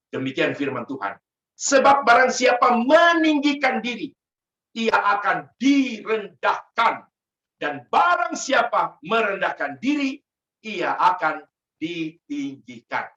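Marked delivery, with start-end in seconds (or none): 1.6-13.1 slow, loud preaching of the verse; forceful scripture reading. Acted. Preacher proclaiming Luke to the congregation, slow and loud. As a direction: proclaim it slowly, full force.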